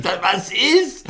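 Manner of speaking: high-pitched puzzled question; retold bewilderment, voice jumping up; mock bewildered reply. Retold startled reply to police.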